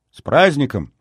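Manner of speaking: put-on high, bright little-girl cheer; joyful greeting. A narrator's reading. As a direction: cheer it out happily.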